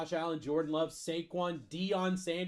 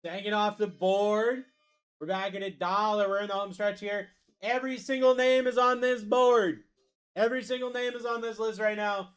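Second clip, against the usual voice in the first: raised, ringing sales call to the crowd; hype announcer pitch; 3 semitones higher, 4 dB louder than usual. Real, not acted. Host selling the home stretch to buyers, hyped announcer pitch. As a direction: pump up the board, holding the key words.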